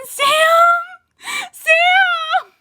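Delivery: calling Sam up high, then a loud cry; anguished fan cry. Real, not acted. Vlogger crying out for a beloved dead character. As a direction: wail the name like a heartbroken fan.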